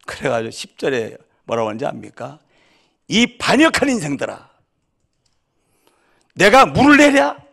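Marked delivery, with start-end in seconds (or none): 3.1-7.4 furious shout. Acted. Moses, enraged, shouting at the rebellious people. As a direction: rebuke them at the top of your voice, pitch climbing. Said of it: "열이 확 받는 거예요".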